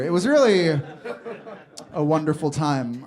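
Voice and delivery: normal talking voice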